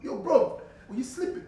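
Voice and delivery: high, loud mock British street accent. Shown as a demonstration. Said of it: "let me come back to the U K".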